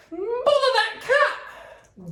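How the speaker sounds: high, slow exasperated mother voice; cross Mrs Thomas. Acted. Missus Thomas scolds the cat, high and exasperated. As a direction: huff it out like a fed-up mum.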